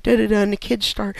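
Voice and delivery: normal talking voice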